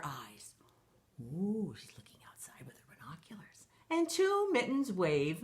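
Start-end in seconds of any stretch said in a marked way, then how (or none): 0.0-1.8 hushed unvoiced storytime whisper. Whispers while spying outside. 1.8-3.7 hushed unvoiced storytime whisper. Whispers about binoculars.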